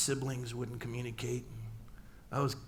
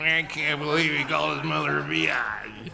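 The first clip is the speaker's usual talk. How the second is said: mock audience; 6 dB louder than usual. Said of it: "don't all get all moral".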